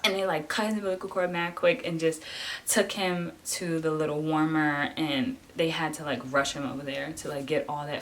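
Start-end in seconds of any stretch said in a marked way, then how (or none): none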